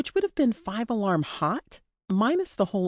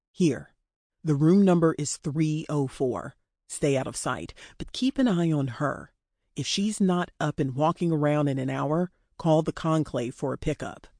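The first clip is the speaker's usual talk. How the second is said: low, controlled male boss voice; brief an underling with calm authority; captor giving orders; 3 semitones lower than usual. Acted. Dean gives orders.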